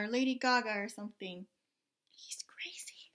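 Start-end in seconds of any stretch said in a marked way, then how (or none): 2.1-3.1 soft unvoiced aside; whisper. Real, not acted. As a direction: barely breathe it. Aside to child.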